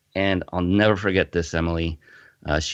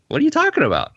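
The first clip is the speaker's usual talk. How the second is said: much higher and louder, disbelieving; incredulous disbelief; 5 dB louder, faster than usual. Retold or imitated. Patient rejects the nurse's news in disbelief. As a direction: fire it back in denial. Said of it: "So I'm like".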